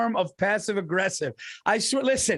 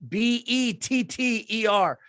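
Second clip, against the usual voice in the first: letters spelled out slowly, one by one; 3 semitones higher, slower than usual. Shown as a demonstration. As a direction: spell each letter clearly for the listener. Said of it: "spells the ad keyword".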